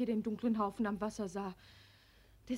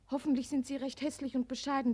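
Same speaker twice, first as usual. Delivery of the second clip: ordinary talk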